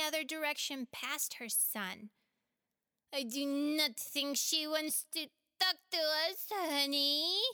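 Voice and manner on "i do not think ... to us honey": higher, louder plaintive complaint; disappointed little creature; bemoaning. Acted. Chulur complains to Henny that Maria avoids them, bemoaning. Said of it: "Chulur bemoaned".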